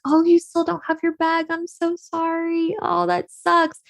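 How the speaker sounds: high, soft mock sympathy; pitying friends. Friends pity her missing suitcase, in a high soft cooing voice.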